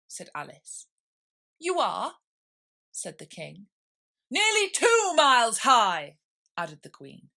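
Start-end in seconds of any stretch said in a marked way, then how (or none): 1.6-2.2 high, loud indignant king. Acted. King contradicting Alice, high and loud. 4.3-6.2 loud, slow bossy queen. Acted. Queen overruling Alice, loud and slow.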